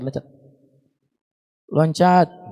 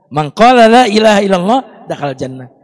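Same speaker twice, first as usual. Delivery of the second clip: recited hadith; 9 dB louder than usual. Acted.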